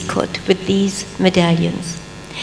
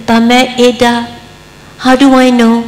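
loud, raised pleading question; Abraham's plea; 4 semitones higher, 7 dB louder than usual. Acted. Abraham crying out loudly to God, pleading.

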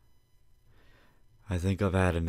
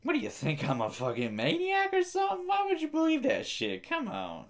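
much higher squeaky indignation; mock outraged squeal. Scolding viewers for believing, high mock outrage. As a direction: squeal in fake offense.

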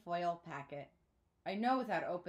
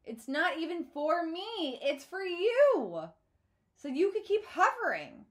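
loud, high and slow accusation; furious; 9 semitones higher, 9 dB louder, slower than usual. A narrator's reading. Daughter to her father, furious about the phone. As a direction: spit the words at him.

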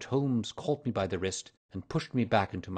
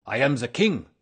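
loud royal declaration, pitch raised; crying out; 4 semitones higher, 9 dB louder than usual. A narrator's reading. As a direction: proclaim it in an agitated outburst. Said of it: "he cried".

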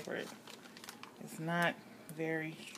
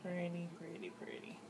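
whisper; 8 dB quieter than usual. Real, not acted. Whispering over the cut soap.

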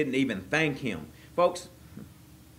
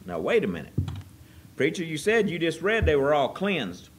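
usual speaking voice

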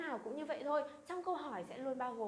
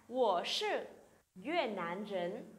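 slow, careful Mandarin answer; slow model reading; 3 semitones lower, much slower than usual. Shown as a demonstration. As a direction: spell it out slowly for learners. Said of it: "chúng ta sẽ trả lời".

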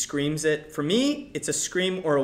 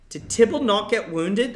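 higher, louder, outraged repeat; indignant repeat; 6 semitones higher, 8 dB louder than usual. Retold or imitated. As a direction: ask it again, now indignant. Dying Mercutio asks twice.

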